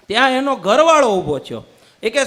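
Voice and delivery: ordinary delivery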